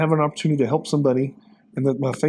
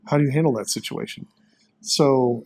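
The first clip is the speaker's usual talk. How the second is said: ordinary talk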